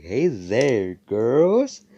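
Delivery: high, loud sing-song come-on; flirty greeting. Retold or imitated. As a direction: slide up into a cheesy flirt. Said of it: "He's like".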